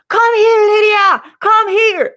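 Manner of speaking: high, loud calling yell; retold scream. Friend screaming from the back of the venue, urgent yell. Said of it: "I hear a scream at the back".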